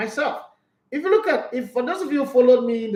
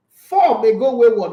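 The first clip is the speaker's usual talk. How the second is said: louder punch; 8 dB louder, slower than usual. Real, not acted. Urging shots, rallying.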